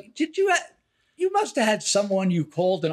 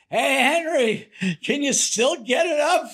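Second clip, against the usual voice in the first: retold bawdy yell; 6 semitones higher than usual. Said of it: "she yells down to Henry".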